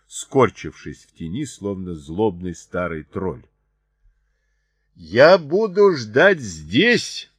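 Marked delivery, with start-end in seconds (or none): 4.9-7.3 high, loud, slow drawn-out call; slow shouted challenge; shouting. A narrator's reading. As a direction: stretch each word out to carry far. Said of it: "крикнул Чарли".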